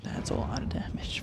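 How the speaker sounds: breathy mock whisper of the meme. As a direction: hush it like a secret. Echoing the meme again.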